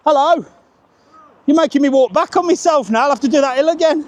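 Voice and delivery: excited greeting. Real, not acted. Greeting a stranger who has just appeared on the hill, high and excited. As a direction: call out to a surprise passer-by.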